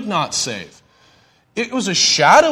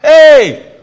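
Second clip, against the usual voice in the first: loud, high-pitched "hey"; calling out; 8 semitones higher, 11 dB louder than usual. Acted. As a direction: holler it to get someone's attention. Calling out a slang greeting.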